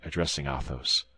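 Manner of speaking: plain reading voice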